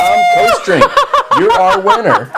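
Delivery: laughing while announcing. Real, not acted. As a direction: let laughter break through the words. Laughing while naming the winner.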